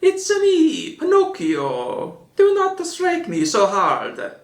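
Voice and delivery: put-on high squeaky Italian accent; hammed-up Italian accent, pleading; very high, slow Italian accent. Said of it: "I shall do an authentic Italiano accent".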